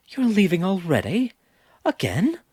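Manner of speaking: high, pleading disbelief; distraught. A narrator's reading. Rowan, dismayed they're leaving, asks in distress. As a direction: voice jumping up in dismay. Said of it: "suddenly distraught".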